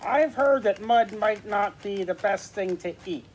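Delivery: high, cheerful puppet character. Acted. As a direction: pitch it up for a chatty puppet. New puppet joins the conversation.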